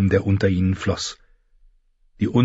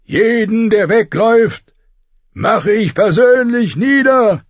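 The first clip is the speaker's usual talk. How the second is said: high, loud brutal bellow; roaring; 13 semitones higher, 5 dB louder than usual. A narrator's reading. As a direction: roar the threat like a brute warlord. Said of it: "brüllte er". (brüllend, hoch und laut)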